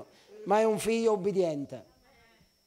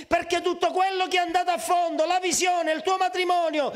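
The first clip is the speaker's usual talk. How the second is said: high, sustained exhortation; fervent high-pitched preaching; impassioned preaching; 8 semitones higher, 3 dB louder than usual. Real, not acted. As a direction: drive every item home with urgent pulpit force.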